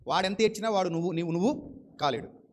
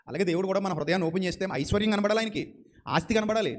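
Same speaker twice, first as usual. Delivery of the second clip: usual speaking voice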